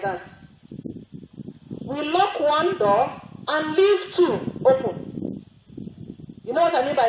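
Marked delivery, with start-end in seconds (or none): 1.8-5.4 high, slow, loud, each word spaced out; slow emphatic preaching. Real, not acted. Preacher to the congregation, slow emphatic warning. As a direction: drive the point home slowly.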